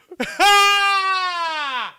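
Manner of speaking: high squealing laugh; shrieking laugh. Real, not acted. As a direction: burst into a shrill shriek. Laughs at Disney idea.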